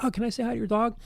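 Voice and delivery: high, gushing voice; excited dog lover. Retold or imitated. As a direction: gush over the dog.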